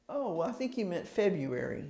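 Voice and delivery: mild soft tone of a polite corrector; polite correction. Retold or imitated. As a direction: gently set someone right. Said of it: "they're gonna go".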